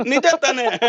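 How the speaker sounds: high, slow laughing question. Real, not acted. Host checks agreement after his idol argument, laughing.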